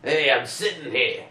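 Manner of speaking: loud, high, drawn-out New York protest; mock New York accent. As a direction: complain like a caricature New Yorker. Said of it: "sounds identical to a New York accent".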